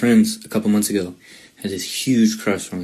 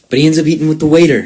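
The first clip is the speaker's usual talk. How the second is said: excited punchline; 7 semitones higher, 8 dB louder than usual. Real, not acted. Punchline of stood-up story.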